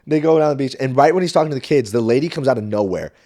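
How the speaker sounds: ordinary talk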